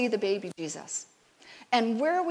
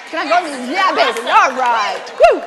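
excited; 10 dB louder than usual. Acted. Excited asking to join choir.

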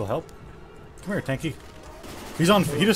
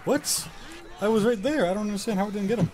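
high, fast exasperated disbelief; 8 semitones higher than usual. Real, not acted. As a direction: sound baffled and high. Shocked at missed attack.

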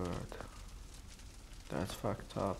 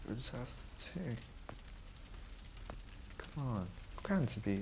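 half-voice the characters under your breath; reading game dialogue; 9 dB quieter than usual. Acted. Gamer quietly reading the cutscene dialogue to viewers, subdued.

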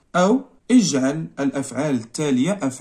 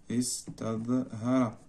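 slow dictation; 3 semitones lower, 9 dB quieter, much slower than usual. Shown as a demonstration.

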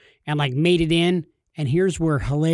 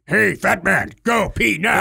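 lightly voiced bossy bladder, louder; 4 semitones higher, 5 dB louder than usual. His bladder ordering him to the bathroom, a hint of a bossy voice. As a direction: give the bladder a pushy little voice. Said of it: "and it's saying".

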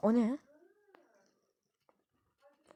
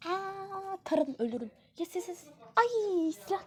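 excited yelps; 8 semitones higher, slower than usual. Real, not acted. Outbursts during fight.

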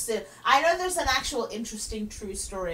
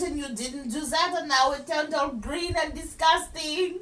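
indignant fake French, rising louder; mock French accent; 3 semitones higher, 6 dB louder than usual. French giver wants the statue back, indignant mock French. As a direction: scold in a hammed-up French accent. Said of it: "doing the French accent".

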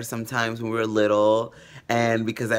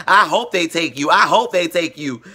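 higher, louder mock-angry mom; angry mother; 6 semitones higher, 7 dB louder than usual. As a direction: snap it back like a fed-up mother. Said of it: "she'll be like".

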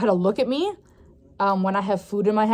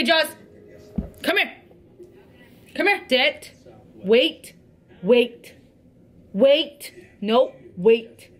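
ordinary delivery

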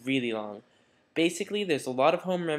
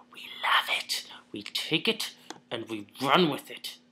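whisper. Acted. Dramatic aside about celebrity gossip.

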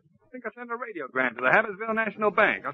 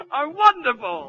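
forced laugh; 9 semitones higher, 5 dB louder, slower than usual. Acted. Bluffing wife he is fine.

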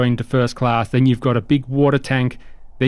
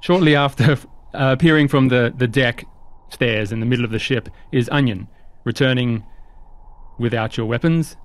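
ordinary talk, nothing marked

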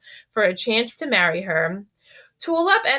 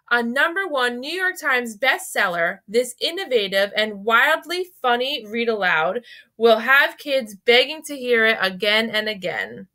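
ordinary delivery

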